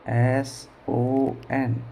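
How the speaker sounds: very slow, letter by letter; each letter held apart; spelling out. Shown as a demonstration. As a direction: spell it out slowly for a class. Teacher spells the word out.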